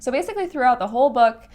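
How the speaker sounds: ordinary talk, nothing marked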